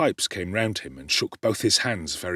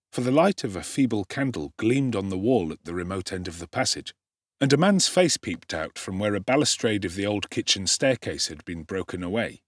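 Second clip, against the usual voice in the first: plain reading voice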